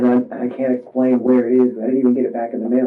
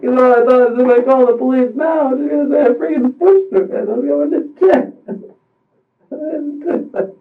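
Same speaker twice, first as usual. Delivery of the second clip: sobbing out the fear of jail; crying; 9 semitones higher than usual. Real, not acted. Telling the investigator she stopped responding, sobbing. Crying while recounting the fall.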